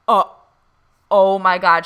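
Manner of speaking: reliving the moment it hit her; stunned gasp. Retold or imitated. Hearing the medium name the chain, loud stunned surprise.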